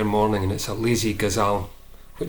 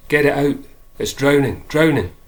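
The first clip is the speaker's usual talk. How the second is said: raised, louder and higher cry; yelling; 5 semitones higher, 6 dB louder than usual. A narrator's reading. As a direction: call it out urgently within a poem. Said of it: "That night she yells".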